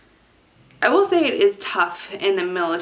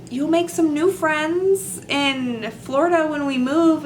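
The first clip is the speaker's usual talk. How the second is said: reassuring; 9 semitones higher, 3 dB louder than usual. Retold or imitated. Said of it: "And I was like".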